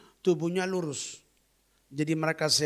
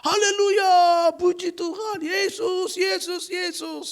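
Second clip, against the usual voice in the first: high, loud, slow cries of praise; husband weeping and praising as his wife moves; tearful praise; 14 semitones higher, 6 dB louder, slower than usual. Retold or imitated. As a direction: sob out his thanks to Jesus.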